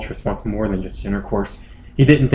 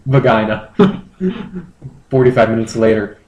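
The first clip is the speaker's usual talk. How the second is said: ordinary delivery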